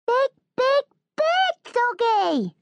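put-on very high, slow toddler squeal; little daughter Minnie. A narrator's reading. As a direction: squeal it out like an excited small child. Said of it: "says Minnie".

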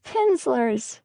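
high-pitched narrated shriek, no added volume; contained, high little squeal; child's shriek. A narrator's reading. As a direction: pipe up excitedly as the youngest child. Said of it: "shrieked Claudia".

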